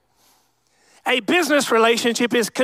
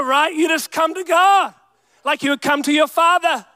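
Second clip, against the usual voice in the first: normal talking voice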